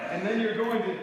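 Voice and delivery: ordinary talk, nothing marked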